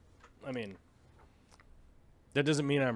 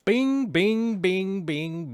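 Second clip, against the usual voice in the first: sound effect; 8 semitones higher, 7 dB louder, much slower than usual. Pretend. Sound effect while editing.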